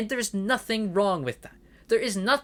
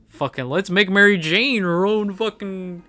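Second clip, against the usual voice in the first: mocking the writers; 4 semitones higher, 5 dB louder than usual. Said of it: "It wasn't done to just be like".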